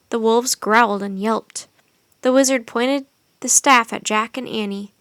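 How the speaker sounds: ordinary delivery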